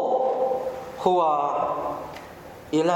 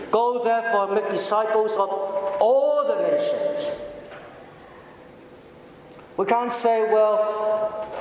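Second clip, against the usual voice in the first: fervent preaching; 4 semitones higher than usual. Real, not acted. Preacher urging the church to reach all nations, fervent preaching. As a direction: preach it with growing urgency. Sermon builds to fervent appeal.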